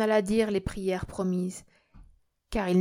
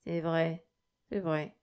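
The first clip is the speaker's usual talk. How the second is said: low, softer, sorry admission; piteous murmur; 3 semitones lower, 3 dB quieter, slower than usual. A narrator's reading. Sick sinner admits to the priest, piteous. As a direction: murmur it pitifully, giving in. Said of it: "murmura Nikita d'un ton piteux".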